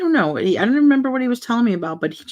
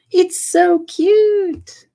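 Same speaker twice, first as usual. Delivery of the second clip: a much higher, louder, very slow coo; gushing; 12 semitones higher, 6 dB louder, much slower than usual. Real, not acted. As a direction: coo over something tiny and adorable. Gushing over tiny plant piece.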